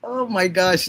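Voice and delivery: normal talking voice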